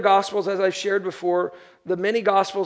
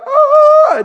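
very high, loud wail; hammed-up "ohh" shriek of alarm; mock panicked scream; 13 dB louder than usual. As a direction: imitate a crowd screaming. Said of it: "You see people freaking out".

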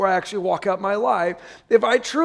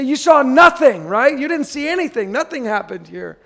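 louder, higher insisting nobody saw; mock embarrassed cover-up; 4 semitones higher, 7 dB louder than usual. Someone who tripped in public telling the laughing guy he saw nothing, hammed-up. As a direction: brush it off with a hammed-up denial.

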